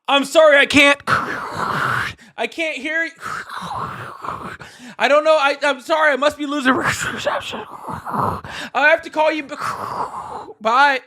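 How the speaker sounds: high, strained phony apology; faking bad reception. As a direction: pretend the call is breaking up. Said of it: "He pulled a".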